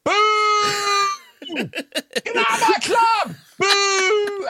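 boo the team off the pitch; booing fan. Shown as a demonstration. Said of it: "how does this sound for Wednesday night".

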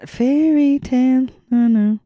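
ordinary talk, nothing marked

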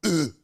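loud imitated exertion grunt; short mock gym grunt; workout grunt. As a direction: grunt like you're lifting weights. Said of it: "when you work out, you go like".

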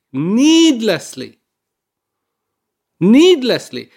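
"needlessly" drawn out slowly, then hit harder; building emphasis. Real, not acted. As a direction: hammer home "needlessly", rising with each repeat. Mourning needless deaths, repeated word.

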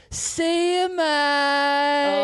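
very high, long-held notes; patronizing singsong farewell; sing-song taunt. Retold or imitated. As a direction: stretch it out like a gloating rider gliding past. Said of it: "in a little sing-song voice".

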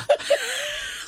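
a sudden high-pitched laugh; bright giggle breaking out. Real, not acted. Laughing while retelling goodbye.

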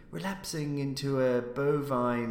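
plain reading voice

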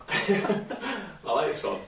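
giggling as he reads; laughing. Real, not acted. Laughs while reading the phrases.

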